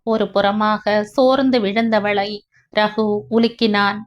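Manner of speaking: ordinary delivery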